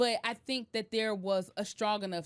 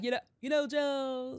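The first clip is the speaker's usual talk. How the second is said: slightly higher, half-hearted presidential impression; lightly imitated statesman voice; Obama impression; 4 semitones higher, slower than usual. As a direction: take a loose, not very good stab at a famous politician's voice. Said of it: "that Obama voice".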